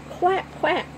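high quacking; child playing along, mock quacking; mock duck quack. A narrator's reading. Children join the animal game.

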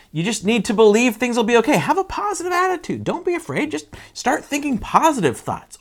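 high, chirpy pep talk; hammed-up, sing-song optimism; mock cheerleader. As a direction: gush empty positivity. Mocking positive-thinking advice.

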